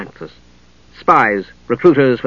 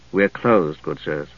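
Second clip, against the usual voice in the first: low woman's voice; 9 semitones lower, 7 dB quieter, slower than usual. A narrator's reading. Woman answers door at night.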